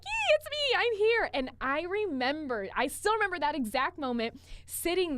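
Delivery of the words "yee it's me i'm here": high, loud squeaky "yee, it's me"; mock-giddy self-announcement; excited squeal. Sitting in the photo, squealing at friends. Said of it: "And I'm sitting there like".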